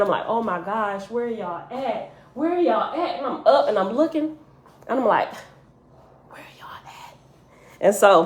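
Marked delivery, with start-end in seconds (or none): 0.3-2.1 soft, drawn-out mock lament; retold crying. Dreamer looking for missing family and friends, retold crying. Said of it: "And I'm crying". 2.3-3.2 higher-pitched pleading call, mildly tearful; retold crying plea. Dreamer calling for missing family and friends, tearful plea. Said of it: "And I'm crying". 6.3-7.2 barely-there whispered plea; tearful whisper. Retold or imitated. Dreamer searching for missing loved ones, tearful whisper.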